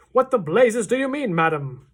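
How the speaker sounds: loud, high outraged father; angry shout. A narrator's reading. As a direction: blast it at her in fury. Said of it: "shouted Mister Jenkins".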